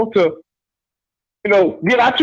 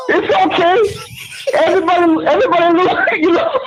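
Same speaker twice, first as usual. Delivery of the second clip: soothing; 7 semitones higher than usual. Acted.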